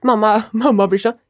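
ordinary talk

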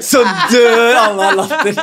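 bursting into real laughter; laughing hard. Real, not acted. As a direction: crack up while telling it. Remembering funny moment with cousins.

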